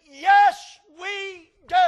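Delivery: loud, high retort; parent shouting back. Acted. Parent answers the sassy child, shouting.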